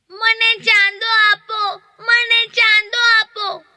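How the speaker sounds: put-on high pleading child voice for baby Ram; a small boy reaching up, asking for the moon; little child begging. A narrator's reading. Teacher voicing baby Ram begging for the moon, high childlike plea. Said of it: "હાથ લાંબા કરીને કહેવા લાગ્યા".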